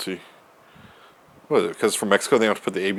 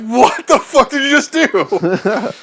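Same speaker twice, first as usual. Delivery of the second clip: high, slow, laughing disbelief; shocked disbelief; 13 semitones higher, 5 dB louder, much slower than usual. Retold or imitated. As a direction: gasp it out in amazement. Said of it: "she's like".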